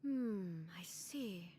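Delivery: whispered aside. Acted. Muttered aside to self.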